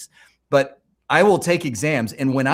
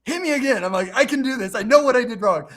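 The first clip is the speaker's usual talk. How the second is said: much higher, louder, pumped-up insistence; fired-up determination; 10 semitones higher, 4 dB louder, faster than usual. Retold or imitated. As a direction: psych yourself up for another round. Calling back for third retake.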